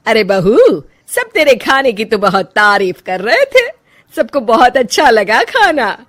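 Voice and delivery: loud, laughing praise; cheerful mother-in-law. Acted. Mother-in-law praising daughter-in-law's cooking, loud with a laugh. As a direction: beam as you pass on the compliments.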